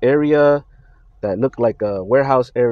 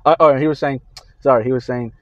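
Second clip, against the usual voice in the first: normal talking voice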